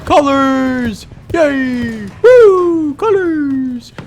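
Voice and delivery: high, loud drawn-out squeals; mock-gleeful cheering. Streamer cheers the colorful battle, mock-gleeful high cheering. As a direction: cheer like an overexcited kid, playfully.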